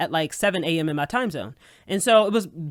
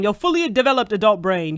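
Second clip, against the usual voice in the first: ordinary talk, nothing marked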